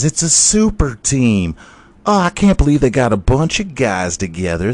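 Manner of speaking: high, loud whining rant; mock outrage. Fan ranting about the super team, high and loud.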